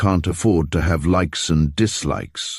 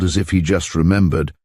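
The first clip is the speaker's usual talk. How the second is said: ordinary delivery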